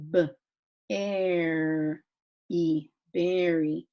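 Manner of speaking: berry built slowly as b, air, e, then whole; sounding out. Shown as a demonstration. Teacher showing pupils the air sound, berry sounded out slowly. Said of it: "words that have the air".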